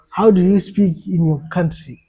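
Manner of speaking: ordinary talk, nothing marked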